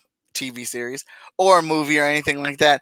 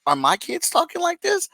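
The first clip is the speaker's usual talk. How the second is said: mock worried parent; 11 semitones higher, 3 dB quieter than usual. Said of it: "makes me think about".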